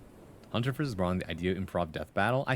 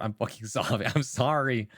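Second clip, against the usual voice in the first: ordinary talk, nothing marked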